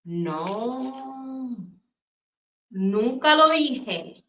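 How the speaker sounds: lightly voiced grandmother, slow flat denial; a hint of a stubborn abuela brushing it off; slow mock-innocent denial; grandma denying. Grandmother denies accusation.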